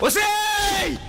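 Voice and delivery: sharp loud shout at her; angry yell. Acted. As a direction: yell to stop her cold. Man yells at woman mid-fight.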